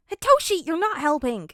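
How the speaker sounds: high, loud yell at the son; frazzled dad shouting at his unhelpful kid; exasperated shout. Acted. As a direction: snap his name out, then yell the rest. Hisashi scolds son, shouting.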